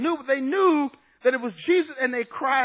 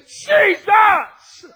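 high, drawn-out shout; crying out; 11 semitones higher, 11 dB louder than usual. Acted. Lepers calling out to Jesus, crying out. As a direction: shout the name with full voice. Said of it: "these lepers are saying".

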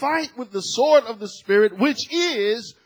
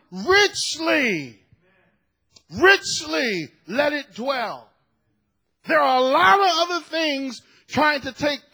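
loud, raised preaching voice; forceful preaching; 4 semitones higher, 4 dB louder, slower than usual. Real, not acted. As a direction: drive each phrase home to the congregation.

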